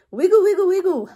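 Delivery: high, loud, lively chant; playful duck waggle. Acted. Acting out the duck in refrain.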